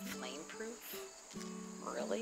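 softly doubtful, questioning echo; mildly incredulous, quietly retold question; disbelieving husband. Husband reading the tag to himself, mildly disbelieving. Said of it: "He didn't believe it".

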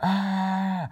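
loud, high-pitched held "aah" scream; pretend scream. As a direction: let out a sustained mock scream. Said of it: "let's try the screaming thing again".

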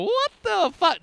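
a passerby's shocked outburst; startled stranger. Retold or imitated. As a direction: sound caught off guard. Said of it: "have people go".

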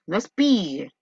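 ordinary talk, nothing marked

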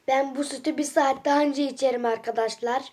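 usual speaking voice

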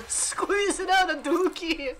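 hammed-up toilet-strain accent; constipated accent. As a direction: squeeze each word out. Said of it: "Just sound constipated the whole time".

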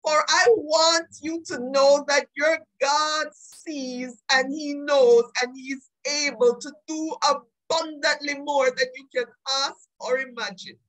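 normal talking voice

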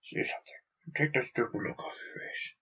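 fast urgent whisper. Acted. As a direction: hiss it so the guards can't hear. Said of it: "whispered urgently".

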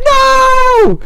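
anguished cry. Retold or imitated. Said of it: "I could picture Blaziken going".

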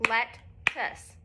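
lettuce split into two soft beats; syllable by syllable, let-tuce; soft clapped syllables. Shown as a demonstration. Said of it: "Now I'm gonna do it".

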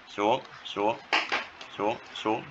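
teacher murmuring to himself while sketching the falling curve; murmured while drawing. Real, not acted. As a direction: murmur along as the pen moves down.

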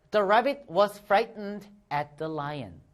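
very slow, careful read-aloud; slow model reading. Shown as a demonstration. Teacher leading the class read-along, very slowly. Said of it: "같이 읽어보겠습니다. 시작!".

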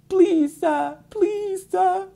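high pleading mock plea; mock begging for mercy, high-pitched. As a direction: whine it like a boss begging. Enterprise head begging for mercy.

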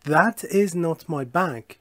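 very slow, carefully spaced English; deliberate classroom model reading; slow model sentence. Shown as a demonstration. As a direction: read it out slowly for learners to copy. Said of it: "teacher models example sentence".

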